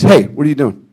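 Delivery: calling out. Retold or imitated. Mel catching him out.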